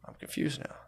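a soft, puzzled breathy aside; confused whisper. Retold or imitated. Imagined confused pamphlet reader.

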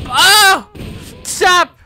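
high, loud startled yell; panicked shout. Acted. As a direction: yelp it out, scared of a pillow. Panics as pillow attacks.

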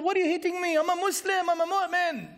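high-pitched hammed-up protest of innocence; mock scream of protest. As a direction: act the beaten man crying out in disbelief. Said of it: "And he screams".

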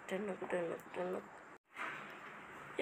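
टुनुक टुनुक whispered, very slow and soft, like tiny steps on stairs; whispered tunuk. Shown as a demonstration. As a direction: breathe out टुनुक टुनुक slowly under your breath. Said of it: "ऐसा उतरने का सीढ़ियाँ". (टुनुक टुनुक धीरे-धीरे फुसफुसाकर)

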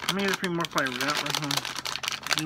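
talking with candy in mouth; mumbled through closed lips; chewing. Real, not acted. Seen 0: Closed puffed lips at start after eating candy; then talks normally.

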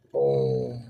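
low, drawn-out boom; imitated crash boom. Sailor retelling the dock collision, a deep booming crash. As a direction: sound out the ship hitting the dock. Dia hentam dermaga tu.

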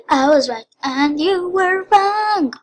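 high, loud, borrowed cartoon taunt; Teen Titans gloat. Player quoting Teen Titans to viewers, gloating. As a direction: crow it loudly, rising in pitch. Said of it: "I got that from Teen Titans".

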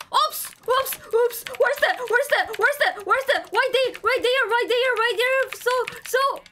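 high, loud panicked rambling; panicked shouting. Real, not acted. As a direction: panic and blurt it out. Panicked in game.

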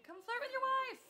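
loud, high command; exasperated ordering shout. Real, not acted. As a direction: holler it at a wandering groom. Ordering Sim to his wife.

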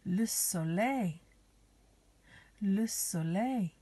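"Le soleil" said slowly in French; French pronunciation. Shown as a demonstration. Teacher to children learning the sun in French, "Le soleil" pronounced slowly. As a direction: model "Le soleil" slowly, ending "-lay". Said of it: "Some of the words in French".

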